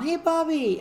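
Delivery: high cheerful call across camp; yelling a greeting. Retold or imitated. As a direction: call her name out happily. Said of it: "I hear people yelling".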